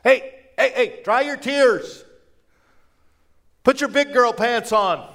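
high, loud mock order; brusque scolding. Imagined Jesus telling girls to dry tears, brusque. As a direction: order them to toughen up.

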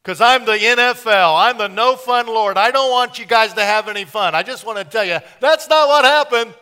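imagined killjoy God listing rules, hammed up; mock killjoy God. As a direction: bark rules like a no-fun Lord. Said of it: "you'd think that God would have said".

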